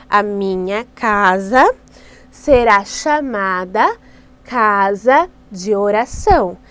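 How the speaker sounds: teaching the verse slowly in chunks; slow, spelled-out verse. Shown as a demonstration. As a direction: dictate it slowly for the kids to copy. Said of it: "Então você faz várias vezes com eles assim, com gestos".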